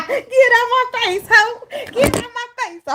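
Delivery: very high, loud mock screech; mock rebuff yell. Telling the returning lover to go, high mock yell. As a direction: screech it in playful outrage.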